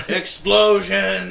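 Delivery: loud, drawn-out exclamation; excited. Acted. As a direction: sell the big idea.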